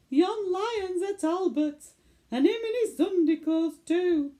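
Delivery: an aggrieved mum wailing to the manager; indignant mother. Acted. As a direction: pitch it up in aggrieved complaint. Mother complains to zoo manager.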